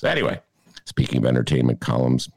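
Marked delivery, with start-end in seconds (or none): none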